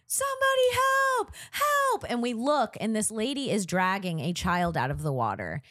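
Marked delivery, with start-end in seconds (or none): 0.1-2.0 high, loud desperate call for help; retold cry for help. Retelling a stranger calling for help from the water, desperate shout. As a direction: yell out for help across the beach. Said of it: "we hear".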